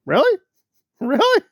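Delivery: high, loud disbelieving really; laughing incredulous really. Real, not acted. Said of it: "incredulous exclamation".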